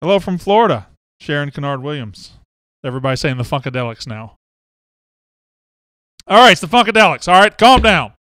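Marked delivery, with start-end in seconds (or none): 6.3-8.1 louder, higher and fast, laughing at the chat; loud, high, excited rush. Real, not acted. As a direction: hype it up, then tell the chat to calm down. Reacting to a flood of chat.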